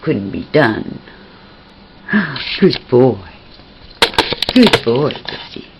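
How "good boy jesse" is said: ordinary talk, nothing marked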